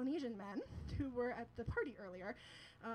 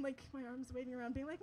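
ordinary delivery

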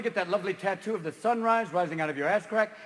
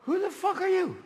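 high baffled question; morning-after shock; 12 semitones higher than usual. Acted. Waking drunk asks the stranger in bed, high shock. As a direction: wake up next to a stranger.

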